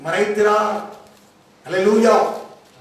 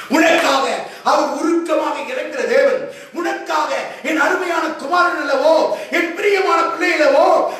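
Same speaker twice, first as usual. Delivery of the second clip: fervent preaching; 7 semitones higher than usual. Acted. Preacher urging the congregation about God's longing, fervent preaching. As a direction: press each phrase on the congregation.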